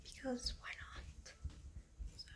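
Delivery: soft whispered aside; whispering. Real, not acted. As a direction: whisper it to yourself. Aside under her breath.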